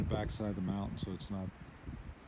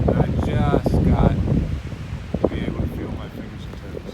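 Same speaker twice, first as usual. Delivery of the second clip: loud raised voice against gusts; shouting over wind; 2 semitones higher, 13 dB louder than usual. Real, not acted. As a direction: shout so the wind won't drown you. Shouting on windy mountaintop.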